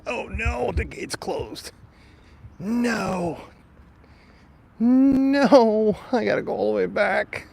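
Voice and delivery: high, dismayed wail at the closed gate. Real, not acted. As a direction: groan it out, stuck behind a locked gate.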